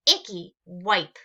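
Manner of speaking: loud, playful cry while wiping; gleeful shout. Acted. Emma wiping the monster's nose, loud and gleeful. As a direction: shout it like a delighted kid. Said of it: "she laughed".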